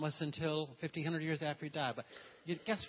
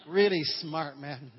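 teasing praise; 11 dB louder, much slower than usual. Real, not acted. Joking praise of quiet men.

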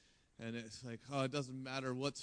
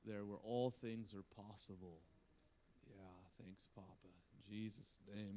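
heartfelt prayer; 7 dB quieter than usual. Real, not acted. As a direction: pray gently, sinking into quiet pauses. Leads the congregation in prayer.

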